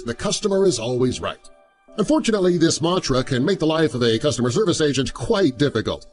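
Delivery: ordinary delivery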